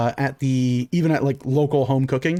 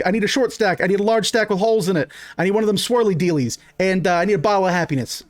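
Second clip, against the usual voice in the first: fast, higher order call-out; 5 semitones higher, faster than usual. Acted. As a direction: rattle the orders off to the cook. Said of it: "He's like".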